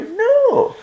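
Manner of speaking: mock disbelief. Mock disbelief at shop stock.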